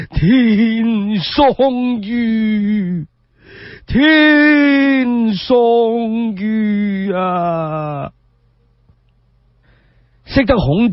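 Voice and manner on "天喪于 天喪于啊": high, very slow crying lament; Confucius weeping aloud. Acted. Confucius mourning Yan Hui, crying out to heaven. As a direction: drag each word out through sobs. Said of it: "孔子不禁失聲痛哭".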